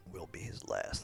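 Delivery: breathy doom-laden whisper; ominous whisper. Acted. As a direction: whisper it like a dark omen. Ominous aside to the table.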